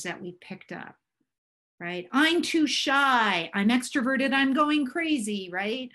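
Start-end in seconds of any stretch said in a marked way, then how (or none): 2.1-5.5 high, loud mock self-talk; exaggerated inner messages. Speaker voicing inner messages to the audience, raised mock complaint. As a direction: act out the nagging inner voice, pitched up.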